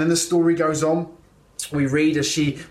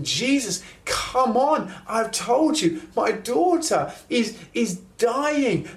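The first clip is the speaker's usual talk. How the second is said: high, urgent pleading with Jesus; frantic impatience; 7 semitones higher than usual. Retold or imitated. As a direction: hurry him up, a desperate father losing patience. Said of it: "I would be thinking".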